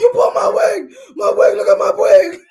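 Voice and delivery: hammed-up crying shout over a pulled wig; imitating a crying yell. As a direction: wail it like a half-mock tantrum. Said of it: "She was like".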